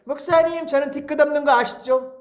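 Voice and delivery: high, smug imitation of a congregant; mock the smug church member, voice up high. Pastor mimics congregant saying he holds no grudge, smug and high. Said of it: "꼭 얄밉게 이렇게 얘기한다니까요".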